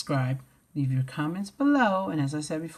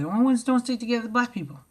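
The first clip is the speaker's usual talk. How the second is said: fast outburst; 6 semitones higher, much faster than usual. Real, not acted. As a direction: blurt it out quickly. Bursts out fast after whispers.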